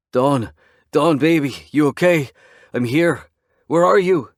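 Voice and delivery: searching the land for his missing woman, calling out. A narrator's reading. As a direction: call across the property in panic.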